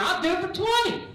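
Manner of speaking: high, loud auctioneer bid; auctioneer kid. Retold or imitated. Kid in the back outbidding, high eager auctioneer call. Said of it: "another voice chimes in like an auctioneer".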